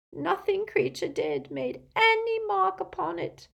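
high-pitched, despairing moan; moaning elf. A narrator's reading. Elf admits he failed to damage the locket, miserable moan. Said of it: "moaned the elf".

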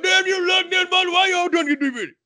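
high, fast exasperated holler; Chef's dad cursing. Retold or imitated. The father curses the monster in high exasperation. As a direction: curse the monster with a shake of the fist. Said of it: "I was asking myself".

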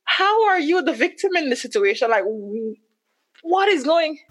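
high-pitched, incredulous protest; confused disbelief. Retold or imitated. Wife retelling her answer to husband claiming victimhood, confused disbelief.

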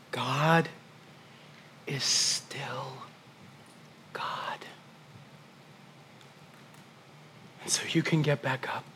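barely voiced, intimate hush; intimate whisper. Pastor whispering hope to his grieving family. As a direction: lean in and whisper the promise.

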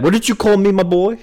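put-on high, loud old-fashioned fighter's challenge; old-timey pugilist. Joking co-host challenges his friend over an insult, old-timey pugilist. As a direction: square up in mock offence, fisticuffs style. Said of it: "like, pugilist, uh, fisticuffs stance".

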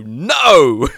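big, loud, drawn-out "no"; hammed-up defiance; mock defiant shout. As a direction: bellow a playful refusal. Acting out refusing the promotion.

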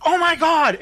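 high-pitched retold excitement; mock thrilled exclamation; excited gasp. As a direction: act out an eager "oh my God". Retelling excited guest grabbing toy.

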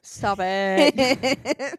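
loud, mock-offended scold. Real, not acted. Scolding her friend for joking about the dying mother, loud. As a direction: tell her to stop, half laughing.

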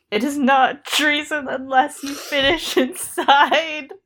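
slow, giggle breaking through; fail to keep a straight face; laughing. Real, not acted. Host repeating the line, breaking into laughter. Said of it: "what happened to Lancel's not funny".

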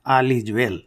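all is well in an Indian accent, soft. Shown as a demonstration. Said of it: "in the Indian accent".

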